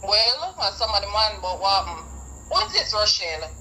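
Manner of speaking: normal talking voice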